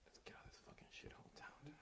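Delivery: soft conspiratorial whisper. Acted. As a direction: lure him away quietly.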